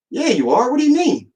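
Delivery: high, surprised mock nurse voice; the nurse can't believe he stayed in; nurse's surprised reply. Nurse surprised he stayed inside, high surprised voice. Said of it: "She goes".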